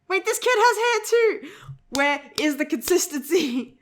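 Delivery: high, slow, put-on voice of Yu; mock Yu voice. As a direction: play Yu as a puzzled complainer. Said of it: "Yu's just like".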